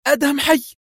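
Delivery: high, overwhelmed exclamation; crying out. A narrator's reading. Agent hears her colleague sent a telegram, crying out. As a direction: exclaim it, voice leaping up. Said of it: "وهي تصرخ".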